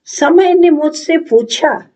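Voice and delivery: declaimed on a high, lifted pitch. Acted. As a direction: lift the line high, theatrically. Monologue line, first take.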